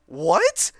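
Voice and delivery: loud high shocked gasp. A narrator's reading. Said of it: "gasped Cadence".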